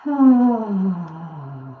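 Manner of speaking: long, loud sigh sliding downward; big releasing exhale with voice; deep sigh. Shown as a demonstration. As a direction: sigh it all out in one long breath. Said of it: "sigh it out".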